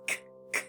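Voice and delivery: breathy unvoiced k, k; whispered k sound demo; isolated k sound. Shown as a demonstration. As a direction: puff out a crisp k with no voice. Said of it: "let's make the sound k".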